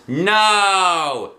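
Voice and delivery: loud, high flat refusal; defiant no. A narrator's reading. The boys refusing Mister Jack, loud. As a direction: refuse together. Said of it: "said all the boys".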